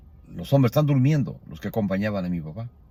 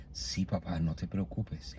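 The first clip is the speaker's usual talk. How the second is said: much softer, gentle murmur to a sick father; softly; 4 semitones lower, 11 dB quieter, slower than usual. Retold or imitated. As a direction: say it barely above a breath, tenderly. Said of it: "le digo despacito". (en voz muy baja, con ternura)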